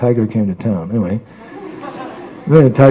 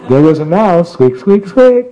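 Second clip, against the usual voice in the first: fresh, playful storytelling with the squeaks climbing higher; told like it's new; 5 semitones higher than usual. Shown as a demonstration. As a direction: make the mouse squeaks rise each time as if telling it for the first time. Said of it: "You have to tell in a certain way like it's new".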